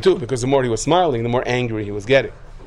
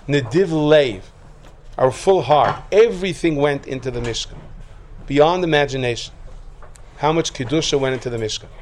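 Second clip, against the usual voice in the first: ordinary delivery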